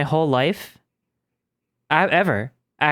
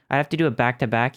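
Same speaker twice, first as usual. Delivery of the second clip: ordinary talk, nothing marked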